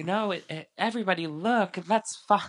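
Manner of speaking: high-pitched mock commotion; Lala. Host imitates Lala trying to get everybody looking, high and urgent. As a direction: imitate someone making a scene for attention. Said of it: "make it be a scene".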